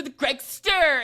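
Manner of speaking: high, loud, stretched-out nickname; mock-grand announcement. Introducing a ridiculous movie character, mock-grand announcement. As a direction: hail the name with hammed-up fanfare.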